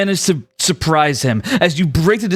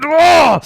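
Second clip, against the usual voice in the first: sharp high pained cry; high pained yelp; scream of pain; 11 semitones higher, 6 dB louder than usual. Acted. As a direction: cry out as if wounded. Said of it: "so he screams in pain".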